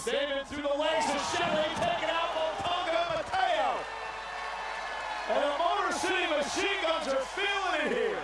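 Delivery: high, loud excited shout. Real, not acted. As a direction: roar the big dive. Big dive called live.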